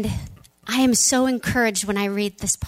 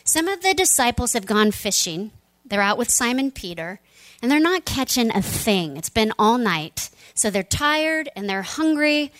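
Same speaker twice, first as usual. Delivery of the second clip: usual speaking voice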